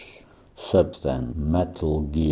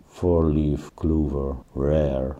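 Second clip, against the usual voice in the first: ordinary talk, nothing marked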